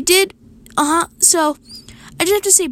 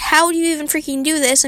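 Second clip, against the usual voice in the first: ordinary talk, nothing marked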